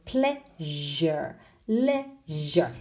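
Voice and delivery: "pleasure", "leisure" pulled apart at a long zh; exaggerated ZH. Shown as a demonstration. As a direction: slightly overdo the zh in "pleasure" and "leisure". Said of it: "Exaggerating slightly so you can hear the difference".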